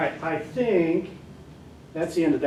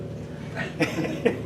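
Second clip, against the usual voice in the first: short laugh; 3 semitones higher than usual. Real, not acted. Laughing at own story.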